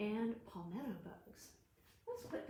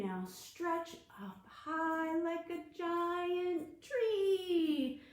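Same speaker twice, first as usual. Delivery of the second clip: slow stretch call; 6 semitones higher, much slower than usual. Shown as a demonstration. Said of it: "movement rhyme for kids".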